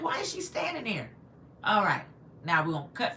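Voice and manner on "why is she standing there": high-pitched puzzled question; retold bafflement. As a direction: act out being baffled by what you see. Retells her puzzlement in the dream.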